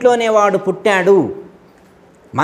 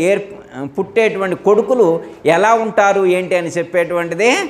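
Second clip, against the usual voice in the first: usual speaking voice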